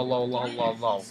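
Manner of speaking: ordinary delivery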